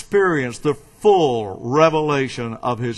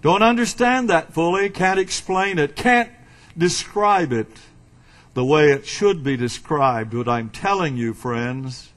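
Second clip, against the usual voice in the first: ordinary talk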